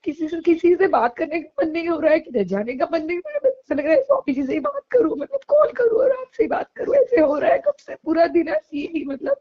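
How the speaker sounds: pleading through real sobs; crying while talking. Real, not acted. Tearful devotee begging her guru to let her call. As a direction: voice breaking into tears, pitch jumping up. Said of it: "तब से रो ही जा रही हूँ मैं".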